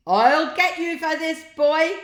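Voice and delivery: high, loud screaming threat; furious scream. A narrator's reading. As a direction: scream it with rage, dangling upside down. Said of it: "screamed Mister Rath".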